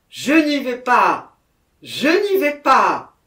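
high, loud, drawn-out refusal; crying out. A narrator's reading. Jacquemin breaking away from the gendarmes, crying out. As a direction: shout it desperately as you tear yourself free. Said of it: "s'écria Jacquemin en s'arrachant des mains des gendarmes".

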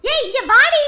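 high loud call; loud summons. Acted. Mother summoning her daughter, loud sharp call. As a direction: order her over at once.

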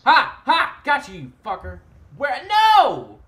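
loud, high triumphant shouts; excited yelling. Real, not acted. Game triumph then shock.

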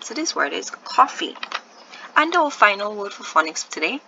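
ordinary talk

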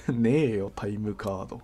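ordinary talk

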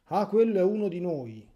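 loud, high mocking voice; mocking quote. Priest mocks people calling a priest one of them. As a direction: sneer out the silly claim.

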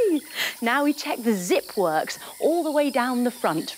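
ordinary talk, nothing marked

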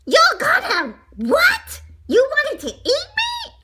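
very high, loud shriek. A narrator's reading. Donut learns he is to be eaten, shrieking. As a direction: shriek in high-pitched outrage. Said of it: "Arnie shrieked".

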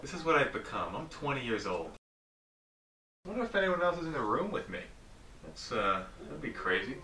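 hushed, low talk to camera; quiet. Real, not acted. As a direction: murmur alone in the dark. Alone in dark, speaking low.

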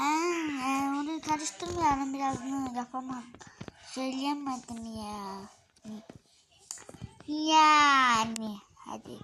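ordinary talk

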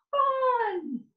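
high squealed fun; a burst of high glee; gleeful cheer. Acted. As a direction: squeal it like a delighted kid. Excited cheer in story.

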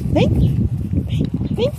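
breathy call to lure the dog; whispered coaxing. Real, not acted. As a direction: whisper come come to the puppy. Coaxing the puppy.

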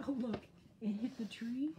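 plain reading voice

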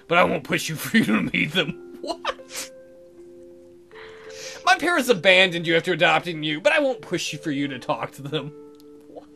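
ordinary delivery

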